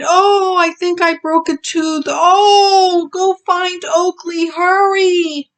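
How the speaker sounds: high, loud, tearful pleading; crying in pain. Acted. Bully with a broken tooth, begging Skeeter for help, tearful. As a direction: beg for help through the tears. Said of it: "he cried".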